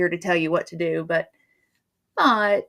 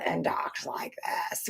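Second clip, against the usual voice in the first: a hint of a cigar-smoking old lady; 11 dB quieter than usual. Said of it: "She sounds like this".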